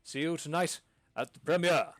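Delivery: stuffy nobleman looking down his nose; pompous lord. Acted. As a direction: lord it over the theatre folk. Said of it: "Lord Fortescue looks up and goes".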